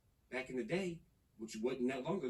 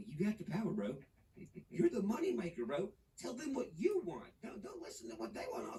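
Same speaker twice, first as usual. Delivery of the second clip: fired-up pep talk; 6 semitones higher than usual. Real, not acted. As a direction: pump up the artists watching, like a coach. Urging artists watching him.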